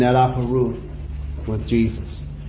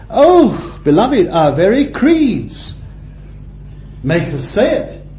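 ordinary delivery

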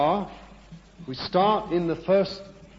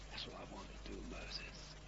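much softer, near whisper to Moses; God whispering to Moses, much softer and lower; hushed voice of God; 8 semitones lower, 25 dB quieter than usual. Acted. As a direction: murmur it close like a secret.

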